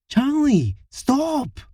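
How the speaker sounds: pleading shout. Acted. Harriet reacts to vomit.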